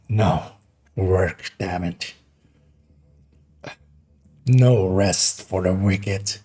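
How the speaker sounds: low, slow gravelly growl. Acted. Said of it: "to go that low gravelly voice".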